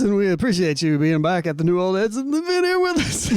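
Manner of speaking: bright, lilting radio announcer; old-timey radio voice. Pretend. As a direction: announce it like a vintage radio host. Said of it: "It's an old-timey radio voice".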